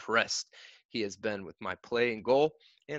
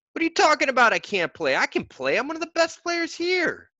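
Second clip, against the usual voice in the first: angry protest; 6 dB louder than usual. A narrator's reading. Boy protests coach's ruling.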